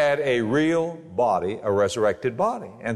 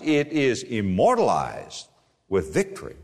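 usual speaking voice

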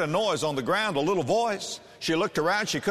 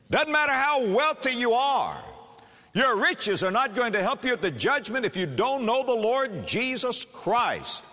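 preaching at full force; 8 semitones higher than usual. Acted. Preacher warns the congregation with rising, fervent force. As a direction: rise to a passionate warning.

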